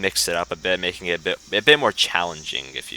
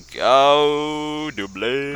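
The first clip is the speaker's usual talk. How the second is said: put-on very old man; 7 semitones higher, 5 dB louder, much slower than usual. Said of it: "I gotta do my old man voice".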